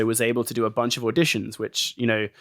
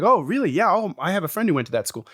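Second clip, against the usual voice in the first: higher, chirpy American accent, playing along; mock-friendly American accent, pitched up; 6 semitones higher than usual. Old friends catching up, chatting in American accents. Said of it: "in our American accents".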